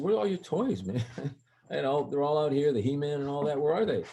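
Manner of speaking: high, exasperated retold dad voice; puzzled questioning. Acts out questioning kids about toys.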